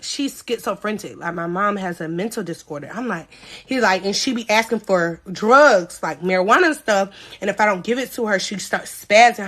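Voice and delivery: lightly voiced lying boyfriend. Quoting Jerry's lie.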